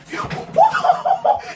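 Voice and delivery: high screaming laugh of hype; shrieking laugh. Real, not acted. Fan reacting to the twist, shrieking laugh. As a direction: cackle out in a shriek.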